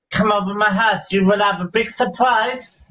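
ordinary talk, nothing marked

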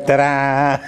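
a playful little ta-da; light mock triumph; mock fanfare. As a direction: present it like a magic trick. Triumphant punchline of his story.

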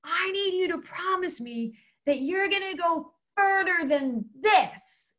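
high mock shout over the wind; retold yell. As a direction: imitate urging a climber on from a distance. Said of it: "we're yelling".

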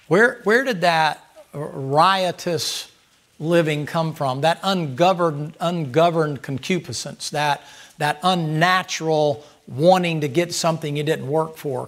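drawn-out, weighty preaching build; slow preaching. Acted. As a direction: stretch each word to drive it home.

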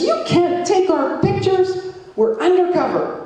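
high, sing-song mock voice for the big cop; mocking officer. Mimicking the Mountie who forbade photographs, in a high sing-song mock voice. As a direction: lampoon the undercover officer in a silly high singsong. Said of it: "And he says".

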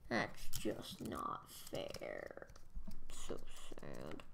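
hammed-up breathy sulk; mock sad whisper. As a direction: whine it under your breath. Repeats it in a whisper.

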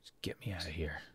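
breathless whisper; whispered order. Acted. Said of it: "And then he says".

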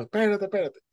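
high, frantic cries; panicked shout. Retold or imitated. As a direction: panic for a friend in the water. Friend urging him to hold on.

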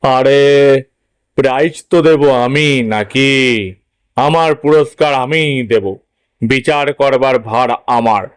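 father's voice, asserting his authority; a father coaxing his boy, sure of himself; self-assured father. Acted. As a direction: voice a dad who decides the prizes himself. Father figure in story.

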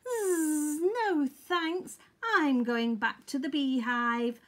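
buzzy bee, starting high and easing down; buzz, then decline loudly and cheerfully; Honeybee. A narrator's reading. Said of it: "hummed Honeybee".

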